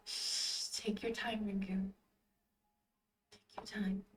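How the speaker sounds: soft, hushed comforting voice; calm, patient. Acted. Yale letting a grieving Rinku take time, calm and patient. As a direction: soothe gently, leaving room to breathe. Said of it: "Yale's voice was calm and patient".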